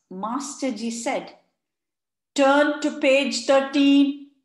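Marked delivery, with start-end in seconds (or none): 2.3-4.3 slow, loud teacher command. A narrator's reading. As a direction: give the class an instruction. Teacher instructs class.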